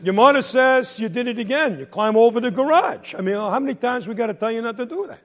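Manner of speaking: hammed-up parental telling-off; scolding father. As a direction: lay out the charge like a fed-up dad. Father scolds son for climbing.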